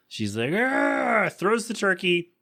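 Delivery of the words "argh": high snapping roar, hammed up; retold furious Lois yell; mock angry yell. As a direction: snap and roar at everyone.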